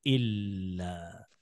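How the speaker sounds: इल्ला slow and low, lam held; doubled lam. Shown as a demonstration. Teacher to children, modelling the doubled lam. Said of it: "इल्ला हो".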